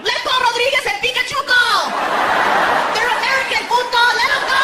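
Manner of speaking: very high-pitched mock protest cries; hammed-up outraged bystander, way up high; passers-by yelling. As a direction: holler from a passing car in a squeaky high voice. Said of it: "People are driving by going".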